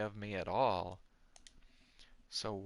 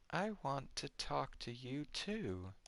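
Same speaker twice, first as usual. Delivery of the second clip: her line softer, trembling a little; trembling girl reply; 4 semitones higher, 6 dB quieter than usual. Acted. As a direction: answer softly with a tremble. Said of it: "her voice trembling slightly".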